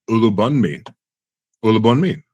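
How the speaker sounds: "Olubanmi" said with English sounds; careful anglicised attempt at "Olubanmi"; English pronunciation. Real, not acted. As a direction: say "Olubanmi" without the Yoruba accent. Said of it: "that's an English way of pronouncing it".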